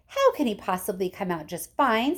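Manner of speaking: usual speaking voice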